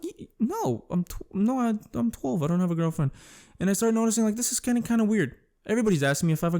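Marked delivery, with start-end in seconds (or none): 0.0-3.1 flustered denial. Retold or imitated. Flustered twelve-year-old denies girlfriend.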